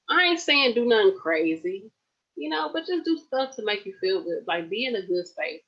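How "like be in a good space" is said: ordinary delivery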